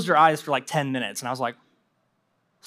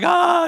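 strained cry; 9 semitones higher, 4 dB louder than usual. Retold or imitated.

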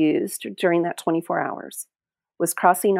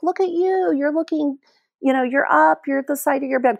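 delighted surprise; 7 semitones higher than usual. Retold or imitated. She greets her mom, surprised.

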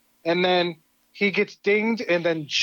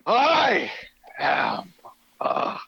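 Skeletor booming demon lord. Acted. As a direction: start dark and hushed, end bellowing like a god. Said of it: "I wanna give you the floor here to do the monologue".

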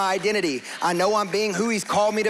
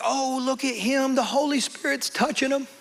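slow, higher, hushed awe, lightly mocked; mock onlookers; 6 semitones higher than usual. Church people marvelling at him crying, in slow mock awe.

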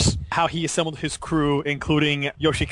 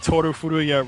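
ordinary delivery

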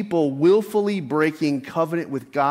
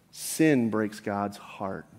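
slow solemn emphasis; 5 semitones lower, 4 dB quieter, much slower than usual. Shown as a demonstration. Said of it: "Pastor Eric Alexander reminds us".

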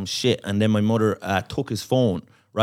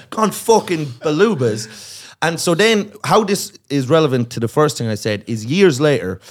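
ordinary delivery

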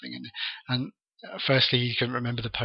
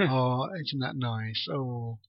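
slow, softer show of polite interest; put-on phone voice; 5 dB quieter, much slower than usual. Disinterested husband humours his wife about a pony, put-on phone voice. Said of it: "sort of voice".

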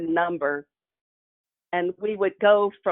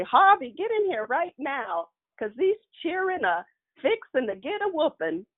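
raised call to Harvey; a touch of a fed-up grandmother; calling for dad; 8 semitones higher than usual. Retold or imitated. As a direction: shout him in from the porch.

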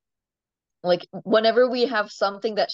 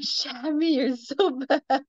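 high, slow teasing scold; playful scolding; 6 semitones higher, slower than usual. Retold or imitated. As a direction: scold the camel fondly. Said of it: "I'm like".

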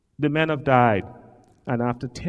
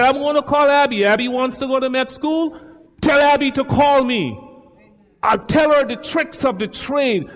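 higher and louder, eager; excited son; 10 semitones higher, 5 dB louder than usual. Retold or imitated. Preacher voicing his son about Abby, excited and high. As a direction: quote him with eager energy.

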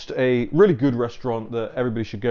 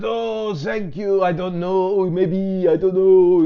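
high, sing-song mock French accent, non-committal; hammed-up French-accented dithering, pitched well up; Emmanuel Macron; 7 semitones higher, 5 dB louder, slower than usual. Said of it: "non-committal".

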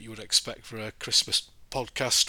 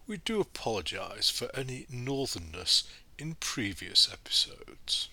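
put-on posh BBC English accent; 3 dB quieter than usual. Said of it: "I have given off my northern tribe accent".

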